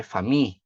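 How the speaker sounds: "fami" as fa-MI, second syllable stressed. Shown as a demonstration. Teacher showing the English tonic syllable, fami stressed on MI. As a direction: put the force of voice on the "mi" of "fami". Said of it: "é a sílaba tônica".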